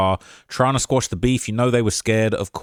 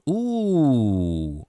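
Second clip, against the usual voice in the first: drawn-out, falling "ooh"; long "ooh". Real, not acted. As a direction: let an ooh glide slowly down. Long reaction while searching.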